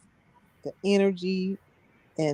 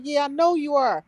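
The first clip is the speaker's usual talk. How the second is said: raised, high mock yell at the judge; retold yelling; 6 semitones higher than usual. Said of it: "I'm yelling".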